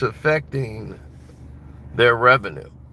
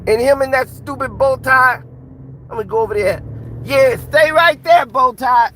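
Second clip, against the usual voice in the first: much higher, louder put-on boxer voice, seething; Mike Tyson; 14 semitones higher, 7 dB louder than usual. Tyson fuming at the people who robbed him, high-pitched and loud. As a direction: play the champ fuming at being robbed, pitched way up. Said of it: "They'll be like".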